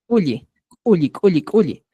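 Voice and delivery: Culli, culli, culli, culli repeated with a hard k; teacher drilling the Cusco form culli with a crisp k; Cusco word drilled. Shown as a demonstration. Teacher modeling Cusco Quechua culli for students, drilling it crisply. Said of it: "En Cusco decimos culli".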